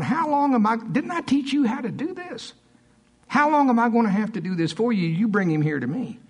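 exasperated, high-pitched rebuke; high complaint easing down into an order; not happy. Retold or imitated. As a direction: act out a teacher fed up with students. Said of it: "he was not happy".

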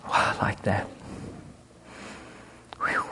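ordinary talk

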